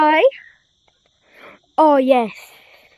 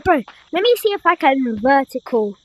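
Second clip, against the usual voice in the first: ordinary delivery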